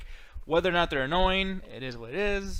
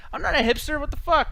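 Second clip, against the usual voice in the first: high, louder indignant protest; mock outrage; 9 semitones higher, 4 dB louder than usual. Real, not acted. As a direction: protest the insult in playful outrage. Outraged at chat's label.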